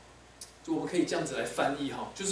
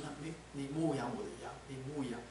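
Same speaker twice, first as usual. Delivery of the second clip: gentle command; 9 dB quieter than usual. Acted. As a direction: say it tenderly and hushed. Jesus gently commissioning Peter. (耶稣温柔低声地吩咐)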